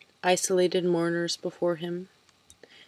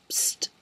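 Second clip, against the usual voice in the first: whispered psst; 5 dB quieter than usual. A narrator's reading. Old lady hisses again.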